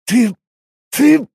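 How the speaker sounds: stuttered start, second word high and loud; splutter, too outraged to go on; choking with indignation. A narrator's reading. Warrior outraged at a quest demand, choking. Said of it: "стал задыхаться непись".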